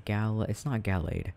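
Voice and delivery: ordinary delivery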